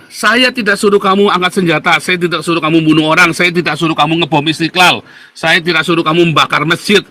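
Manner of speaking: rally the audience forcefully; rallying exhortation. Real, not acted. Speaker telling viewers to resist without violence, rallying cry.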